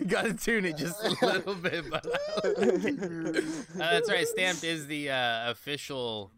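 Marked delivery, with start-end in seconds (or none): 0.0-3.4 laughing. Real, not acted. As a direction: laugh through the line. Laughing through the words.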